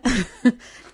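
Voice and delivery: short delighted burst of laughter; joyful laugh. Real, not acted. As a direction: laugh out the joy of the news. Laughs telling of the healing.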